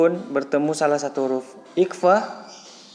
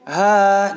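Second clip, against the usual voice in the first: "Ha" held long for one swing; one-beat lengthening; 5 semitones higher, 6 dB louder than usual. Shown as a demonstration. Teacher shows alif lengthening the vowel, long held syllable. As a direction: stretch the vowel of "Ha" evenly. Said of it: "dibaca panjang satu ayunan".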